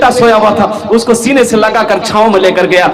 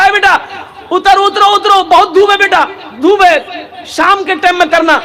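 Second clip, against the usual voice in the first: higher, calling father; worried father calling; 7 semitones higher than usual. Acted. Father calling his son down from the sunny roof. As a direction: call up to him out of concern.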